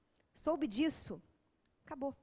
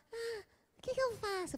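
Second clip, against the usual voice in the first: squeaky high friend's voice; high anxious friend; 10 semitones higher, 3 dB quieter than usual. Retold or imitated. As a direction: play the worried girlfriend, pitched up. Friend voiced asking for advice.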